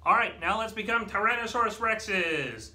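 normal talking voice

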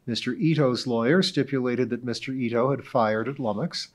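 usual speaking voice

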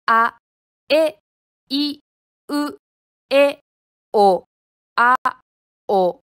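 あ、え、い、う、え、お、あ、お as separate, clearly shaped vowels; vowel mouth exercise. Shown as a demonstration. Teacher leading learners in vowel mouth exercises, clearly separated vowels. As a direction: sound out each vowel from あ to お on its own, with pauses. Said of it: "母音の口体操をしましょう".

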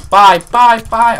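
high, loud taunting byes; gloating shout. Real, not acted. Player seeing off an opponent, loud gloating. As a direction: fire off loud, high goodbyes.

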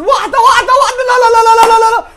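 high excited shouts, laughing; excited shouting. Real, not acted. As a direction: yell no, no, no at the screen. Shot about to go in.

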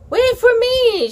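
high, loud shout. A narrator's reading. Little sister shouting to be included, high and loud. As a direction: call out after them, high. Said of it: "she shouted".

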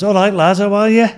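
put-on high, loud hail to an old mate; excited greeting. Greeting his boxer mate at the gym, acted excitement. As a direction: re-enact a hearty, surprised hello.

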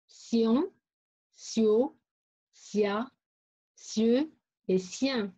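sion, sio, sia, sieux, sien spaced out, each starting with s; syllable drill. Shown as a demonstration. Teacher repeating syllables for pupils, separate sion, sio, sia, sieux, sien. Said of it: "ces syllabes, je prononce T, S".

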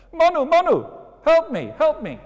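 very high, loud tiny squeak; squeaky fish voice. Acted. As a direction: call out for help like a tiny fish. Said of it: "heard this little voice again".

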